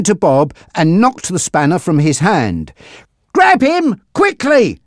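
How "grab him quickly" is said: urgent shout, much higher. Acted. Frankie to Monty, shouting to seize Bob. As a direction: yell the order fast. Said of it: "Frankie shouted to Monty".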